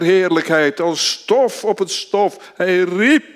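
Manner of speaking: full-force sermon delivery; fervent preaching. Acted. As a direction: hammer each word from the pulpit.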